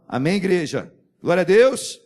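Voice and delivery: loud rising call to the church; full-voiced preacher's shout; shouted praise. Real, not acted. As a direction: lift the crowd with a cry of praise. Preacher calls out to congregation.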